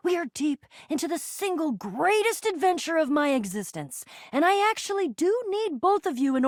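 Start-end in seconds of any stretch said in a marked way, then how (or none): none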